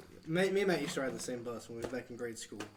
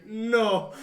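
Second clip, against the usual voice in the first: anguished no; 6 semitones higher, 8 dB louder than usual. Retold or imitated. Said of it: "you just hear".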